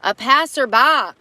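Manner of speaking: ordinary delivery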